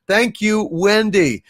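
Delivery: ordinary delivery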